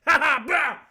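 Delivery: loud, high laugh bursting into a shout; cackle, then bellow to make someone jump; mock startling cackle. Anubis leaps out behind his passenger, loud mock cackle.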